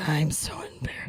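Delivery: embarrassed little whisper. Retold or imitated. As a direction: whisper it, mortified. Embarrassed parent with loud kids.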